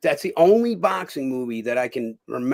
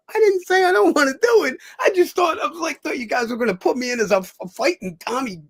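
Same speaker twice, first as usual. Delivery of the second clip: high, loud, half-shouted explaining; excited protest; 11 semitones higher, 4 dB louder than usual. Retold or imitated. Joe explaining to the gym owner, high excited near-shout. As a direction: blurt it out all at once.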